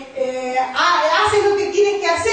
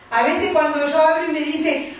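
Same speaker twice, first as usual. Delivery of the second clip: normal talking voice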